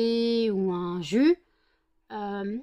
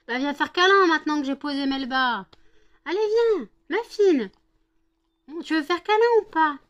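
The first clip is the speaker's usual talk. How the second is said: loud, much higher call to a dog; 7 semitones higher, 9 dB louder than usual. Real, not acted. Calling dog to come cuddle.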